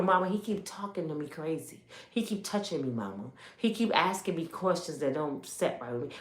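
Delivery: very soft, fearful confession; a child quietly reporting abuse to mother; scared child. Retold or imitated. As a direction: whisper it to mama, afraid.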